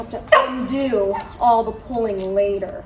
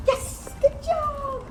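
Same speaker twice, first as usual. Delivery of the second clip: much higher, a delighted cry; excited praise; much slower than usual. Real, not acted. Trainer cheering her dog's success, excited cry. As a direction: burst out cheering for the dog. Dog gets it right.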